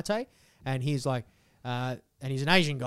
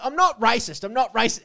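higher, louder mock insistence; mock-defensive mum, pitched up; mum's protest; 7 semitones higher, 5 dB louder than usual. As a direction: protest it high and emphatic, imitating mum.

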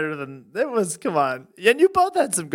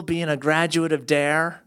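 ordinary talk, nothing marked

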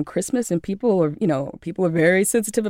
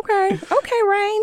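squeaky high okay, okay; high mock impressed. Friend mock-reacting to her range.

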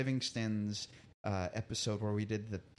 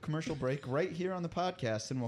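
radio announcer; 6 semitones higher than usual. Acted. Reads a commercial break intro.

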